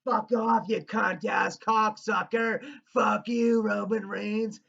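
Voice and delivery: high, loud heckler yell; imitated fan screaming. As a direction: scream abuse from the front row. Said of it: "who's fucking screaming at Roman Reigns".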